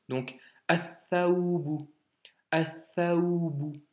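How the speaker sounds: "athaoubu" slow with no L, the tha doubled; slow teaching recitation; lam dropped. Shown as a demonstration. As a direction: stretch "athaoubu" carefully, the lam silent. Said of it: "le lam, il saute".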